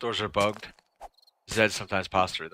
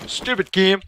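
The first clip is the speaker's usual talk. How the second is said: loud, high snap of annoyance; exasperated yell at the game; frustrated outburst; 10 semitones higher, 9 dB louder than usual. Real, not acted. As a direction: vent at the stupid game.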